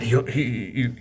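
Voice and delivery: ordinary talk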